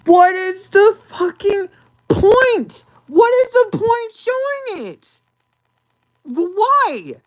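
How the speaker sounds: high, loud, slow cry of disbelief; exasperated outburst. Real, not acted. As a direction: throw your hands up and wail it at the movie. Exasperated rant at the film.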